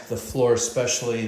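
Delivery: ordinary delivery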